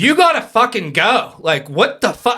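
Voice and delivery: loud, high demand to speed up; re-enacted frantic hollering; exasperated screaming. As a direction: yell impatiently at the driver. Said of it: "I'm just screaming at him the whole time".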